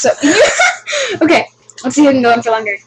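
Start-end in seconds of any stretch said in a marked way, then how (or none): 0.1-1.2 squealing laugh at the sourness; high squealing laugh. Real, not acted. Reacting to sour lemon.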